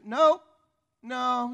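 high, loud burst; emphatic acted denial. God answering a prayer about devil's right, loud emphatic no. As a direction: belt out a sudden firm refusal.

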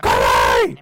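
loud shouted command; retold yell. Owner yelling at the dog to stop, loud shout. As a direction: yell it across the room. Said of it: "And I'll yell, be like".

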